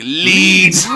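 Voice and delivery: high, loud scoffing echo; disbelieving cop repeating the word loudly; mocking cop. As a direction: toss the word back like it's absurd. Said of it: "And the guy's like".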